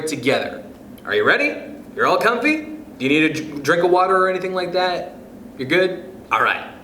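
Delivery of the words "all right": plain reading voice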